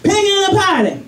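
put-on very high curious little girl; toddler voice. Daughter walking in on dad, high toddler voice. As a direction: ask like a wide-eyed toddler.

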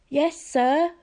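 a frightened satyr replying to the camp director; trembling. A narrator's reading. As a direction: answer scared of the boss. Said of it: "Grover trembled".

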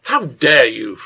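loud, indignant outburst; furious. A narrator's reading. Innkeeper outraged at his wife's accusation, furious. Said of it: "Slade said furiously".

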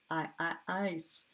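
I, I, ice built slowly from the vowel; sound split. Shown as a demonstration. Teacher building ice from its vowel. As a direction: say I twice then ice, slow. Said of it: "melting piece of ice".

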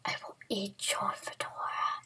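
whispered villain boasts; megalomaniac rant. Pretend. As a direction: hiss out evil plans.

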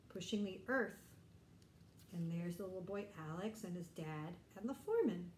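plain reading voice

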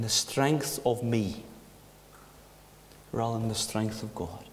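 low, soft prayerful voice; hushed prayer. Acted. Preacher closes the service praying softly to the Lord.

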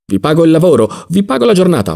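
high, emphatic offer; insistent. Acted. Don Lollò to Ziddima, insistent about paying for the work. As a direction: press the payment on him.

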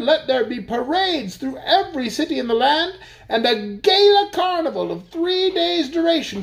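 high, festive announcing voice; Duke's grand decree. A narrator's reading. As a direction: declare the holiday with relish. Duke declares the holiday.

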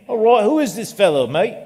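put-on lightly done Australian accent; mock Australian accent. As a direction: ham up an Aussie bloke asking. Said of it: "That was Australian".

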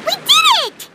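high, bright cheer of triumph; excited cheer. Acted. Companion cheering after fixing the hub, excited.

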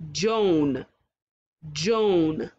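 normal talking voice

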